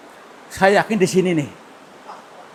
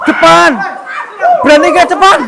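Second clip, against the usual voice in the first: high, loud shouted challenge; loud dare; 11 semitones higher, 12 dB louder than usual. Real, not acted. Daring the spirit to come out.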